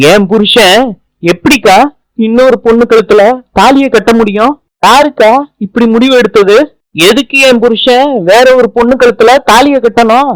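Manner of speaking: high, anguished protesting cry; distraught outcry. Acted. Wife crying out to grandmother about her husband's second marriage, high and anguished. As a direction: cry out in shocked disbelief at the news. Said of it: "எதுக்கு கத்தி கூப்பாடு போட்டுக்கிட்டு இருக்க".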